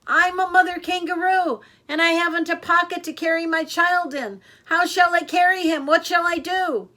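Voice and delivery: high, very loud scream. A narrator's reading. Katie screaming up at the deaf owl in the tree. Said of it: "screamed at him".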